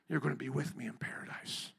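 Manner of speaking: Jesus promises the thief paradise, tenderly soft. Retold or imitated. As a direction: gently reassure.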